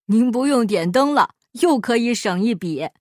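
a second heckler's voice; mocking onlooker. A narrator's reading. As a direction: poke fun at the miser. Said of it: "看热闹的百姓哈哈大笑".

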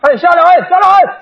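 high, urgent street call; acted holler to get off; shouted warning. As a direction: yell like a pedicab driver at a rider. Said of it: "有蹬三轮的冲我嚷".

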